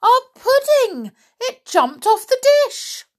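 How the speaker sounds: very high, loud shriek of horror; horrified scream. A narrator's reading. Children seeing their pudding thrown out, horrified shriek. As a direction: scream like the pudding came alive. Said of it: "The children screamed in horror".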